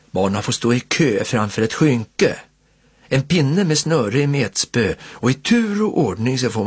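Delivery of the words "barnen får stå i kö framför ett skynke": ordinary delivery, nothing marked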